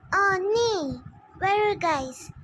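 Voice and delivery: loud, eager phone call; urgent call. Acted.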